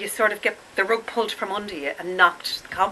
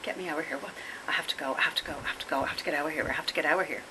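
panicky; 7 dB quieter, much faster than usual. Retold or imitated. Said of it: "I actually feel physically panicky and I'm like".

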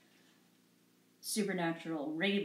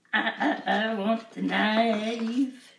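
slow, louder stammered movie-line imitation; Eddie Murphy quote; 3 semitones higher, 9 dB louder, much slower than usual. Quoting an Eddie Murphy line to viewers, slow loud imitation. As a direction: drag out the stutter like the comic bit. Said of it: "It's an Eddie Murphy thing".

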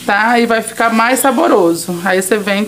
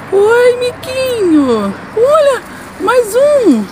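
cooing at a monkey; 14 semitones higher, slower than usual. Real, not acted. Talking to marmosets at market. (falando com o miquinho em voz de bebê, bem aguda)

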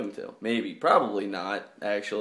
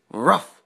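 dog bark; 5 dB louder than usual. Pretend. Barking at sleeping girlfriend.

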